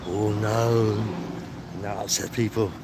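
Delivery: usual speaking voice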